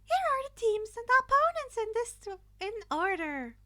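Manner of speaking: high, loud chirpy announcing voice; cheerful principal. A narrator's reading. Principal announcing the teams to the class, high cheerful call. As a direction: announce it like a bubbly host. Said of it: "Netsu cheered".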